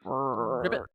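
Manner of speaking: low, rolled "ribbit" croak; frog croak. Pretend. Playing on frog in the throat, low croak. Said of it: "I have a frog in my throat".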